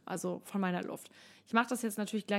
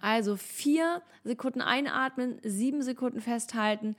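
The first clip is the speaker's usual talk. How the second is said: normal talking voice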